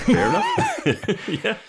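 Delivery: high, slow, laughing squeal. Real, not acted. Repeats with laughter.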